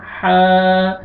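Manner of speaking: हा held out as a long, steady aa; long madd. Shown as a demonstration. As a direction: stretch the vowel of हा. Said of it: "अलिफ से पहले फतहा हो जाएगा". (हा को अलिफ मद्दा के साथ खींचकर)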